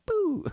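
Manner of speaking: high, falling sci-fi "boo"; imitated Star Trek zap. Teacher likening his noise to Star Trek, high zap. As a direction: make a phaser noise by mouth. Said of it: "or Star Trek".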